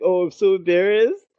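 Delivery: mock embarrassment. Hero embarrassed after anticlimax.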